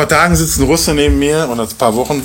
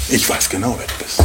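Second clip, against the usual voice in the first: tender hush; 4 semitones lower, 7 dB quieter than usual. Acted. Boas repeated, tender.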